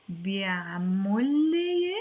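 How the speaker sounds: slow بيعمل لي, bya3-mol le stretched out; slowly joined بيعمل and لي, -mol le; step-by-step demo. Shown as a demonstration. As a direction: draw out بيعمل لي with an o. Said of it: "that's how you pronounce".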